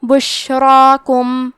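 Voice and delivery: بشراکم with a thick ra and long full alif; heavy ra. Shown as a demonstration. Teacher shows heavy ra and long alif, slow بشراکم. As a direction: stretch the heavy را in بشراکم slowly. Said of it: "مد الف کو بھی بھاری آواز میں ادا کرنا ہے".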